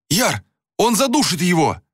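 high, panicked appeal; frantic high-pitched alarm; straining, desperate cry. A narrator's reading. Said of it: "надрывался Анатан, содрогаясь всем телом".

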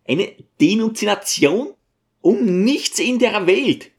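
high-pitched indignant protest; refuse, voice leaping up in outrage; appalled refusal. Acted. Appalled teacher refuses to inform. (empörte Weigerung in hoher Stimme)

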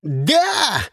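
high, furious one-word yell; drawn-out angry shout. A narrator's reading. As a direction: bellow it in frustration. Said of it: "заорал Сиприт".

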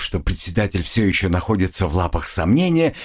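ordinary delivery, nothing marked

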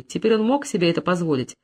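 ordinary delivery, nothing marked

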